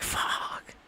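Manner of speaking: breathless whispered fuck; whispered curse. Retold or imitated. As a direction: whisper it under your breath. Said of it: "I'm like".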